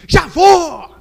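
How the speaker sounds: loud, high bellow back; berro de marido. Acted. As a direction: shout back to prove you're the man. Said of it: "Aqui quem fala mais alto sou eu". (berro alto de marido querendo mostrar autoridade)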